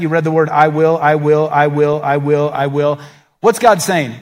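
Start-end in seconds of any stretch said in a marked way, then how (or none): 0.5-3.0 "I will" repeated in an even, insistent beat; emphatic repetition. Shown as a demonstration. Preacher counting God's promises, insistent repeated "I will". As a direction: drum out each "I will" on the same note. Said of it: "did you notice how many times".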